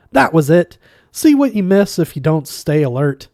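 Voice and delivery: loud, high, gleeful; triumphant. Acted. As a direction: crow with delight. Zaphod triumphant.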